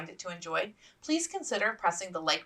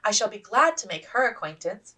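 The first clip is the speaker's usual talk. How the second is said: offhand; 6 semitones higher, 5 dB louder than usual. Acted. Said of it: "she said offhandedly".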